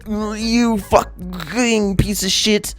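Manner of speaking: high, slow, strangled mock rage; raging. Raging player cursing at a teammate, strangled fury. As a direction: spit it out through choking fury. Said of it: "getting so angry that they fail to type or fail to talk correctly".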